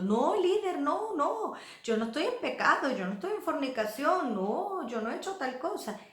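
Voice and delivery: high, sing-song mock denial; protesting innocence. Church member denying sin to the leader, protesting innocence. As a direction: act out an over-insistent, high-pitched denial.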